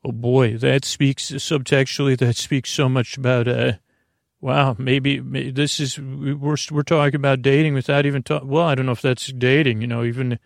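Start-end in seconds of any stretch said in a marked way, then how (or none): none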